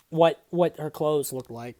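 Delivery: usual speaking voice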